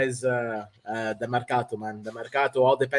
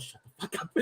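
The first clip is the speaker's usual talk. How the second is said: whispered shut up; 17 dB quieter than usual. Retold or imitated. Boss telling him off.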